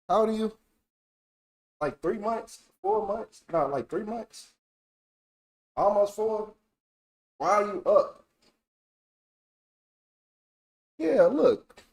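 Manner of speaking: high, soft coo; talking to a kitten. Acted. Talking sweetly to a three-month-old kitten. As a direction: coo gently at the little kitten.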